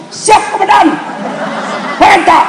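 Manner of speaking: a high, loud snap to attention; eager aide's reply. Retold or imitated. Aide answering the general, loud high "ready sir". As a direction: answer like a startled soldier.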